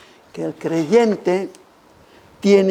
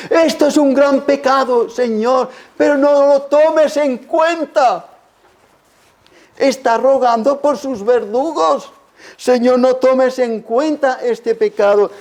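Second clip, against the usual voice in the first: high, pressing sermon delivery; fervent preacher crying out the prayer; impassioned preaching; 6 semitones higher than usual. Acted. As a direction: preach it with raised, pleading urgency. Preaching at full force.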